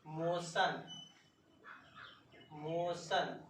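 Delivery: teacher models मोशन as mo-shun; model pronunciation. Shown as a demonstration. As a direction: say मोशन with sha sound, mo-shun. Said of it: "जैसे लिखना है हमको".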